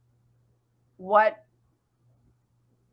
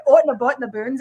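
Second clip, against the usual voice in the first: louder mock Canadian accent with rounded "oot"; 6 dB louder than usual. Shown as a demonstration. Podcaster comparing their own accent to co-host's French one, Canadian accent. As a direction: exaggerate the Canadian vowels in "Boot".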